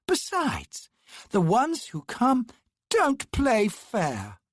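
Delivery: high, prissy, slow scolding; fussy indignant brother. Acted. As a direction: tut at her, fastidious and affronted. Her brother rounded on her.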